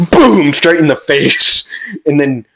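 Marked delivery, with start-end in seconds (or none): 0.1-0.5 high, loud explosion noise; shouted boom. Acted. Explosion straight in the face, loud boom. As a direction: punch out a sudden blast.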